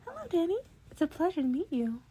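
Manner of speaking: bright, high small-creature voice; eager high-pitched welcome; high chipper Nezu. A narrator's reading. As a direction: greet him like an eager little animal. Nezu greets Danny, high voice.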